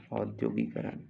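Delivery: ordinary delivery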